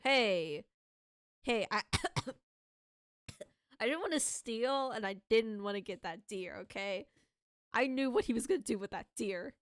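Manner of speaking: loud indignant protest; defensive outburst. Real, not acted.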